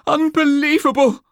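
high shriek of horror. Acted. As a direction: shriek it out in shock. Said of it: "shrieked a monk".

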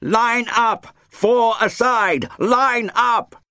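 high, loud drill-ground scream; army officer bellowing at young soldiers over roaring rotors; screamed orders. A narrator's reading. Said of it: "screamed orders at a group".

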